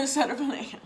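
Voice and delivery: a scoffing laugh at the idea; amused laughing scoff. Real, not acted. Mocking the plan after God laughs.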